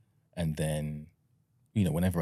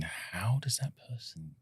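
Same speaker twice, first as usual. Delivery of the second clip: whisper; 5 semitones higher, 6 dB quieter, slower than usual. Retold or imitated. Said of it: "you always question".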